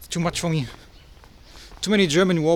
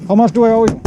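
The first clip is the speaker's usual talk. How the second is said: louder, asking the price across the counter; loud to a shopkeeper; 4 semitones higher, 9 dB louder, much faster than usual. Real, not acted. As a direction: make sure he hears the question. Paying the shopkeeper.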